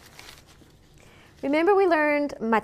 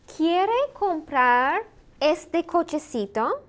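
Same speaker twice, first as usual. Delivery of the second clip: slow, higher-pitched, drawn-out question; slow model question; 4 semitones higher, slower than usual. Shown as a demonstration. Teacher modelling a practice question for learners, slow and deliberate. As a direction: model it word by word, rising at the end.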